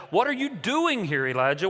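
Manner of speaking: "doing" lifted high and held; stress on DOING. Shown as a demonstration. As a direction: hit "doing" with a pitch peak. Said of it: "whatever word you emphasize".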